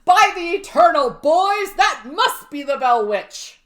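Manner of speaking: big mock-heroic shout; Andrew Jackson yelling. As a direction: holler it out theatrically, slowing toward the end. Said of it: "he apparently yells, quote".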